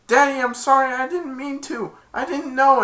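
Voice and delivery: high-pitched acted apology; pleading daughter. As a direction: plead with father in a high little voice. Daughter pleads with her father.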